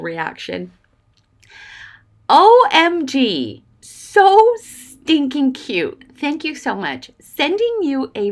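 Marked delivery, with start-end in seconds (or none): 2.3-6.0 high and loud, stretching out "stinking cute"; overjoyed squeal. Real, not acted. Thanking the viewer who sent the gift, overjoyed and teary. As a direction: gush like the gift just undid you.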